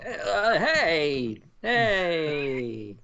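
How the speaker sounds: high-pitched cartoon plumber greeting; drawn-out, high cartoon hello; Luigi. Cohost asked how the movie's voice will sound, high cartoon voice. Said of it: "how is his Luigi gonna sound".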